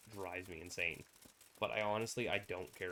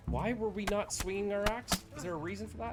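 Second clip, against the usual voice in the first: frustrated; 10 semitones higher, 6 dB louder than usual. Real, not acted. Frustrated mid-fight.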